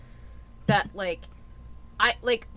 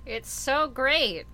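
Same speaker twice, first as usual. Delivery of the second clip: slow, drawn-out sarcasm; sarcastic; much slower than usual. Real, not acted. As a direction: stretch out the mock praise, heavy with irony. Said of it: "Do you hear my sarcasm?".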